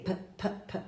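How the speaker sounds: "P, P, P" as soft voiceless puffs; dry P. Shown as a demonstration. Teacher isolating the wrong ending, dry voiceless "P, P, P". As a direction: pop "P" three times, without voicing. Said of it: "um P seco".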